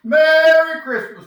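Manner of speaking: loud, slow, high Merry Christmas; booming festive welcome; big Santa greeting. Acted. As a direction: call it out like Santa to a crowd.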